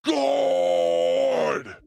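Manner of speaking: high, drawn-out shout of GOD; chest-voice belt. Shown as a demonstration. Showing co-host the chest belt, long holler. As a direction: sustain it like a big singer. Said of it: "put your hand there and go".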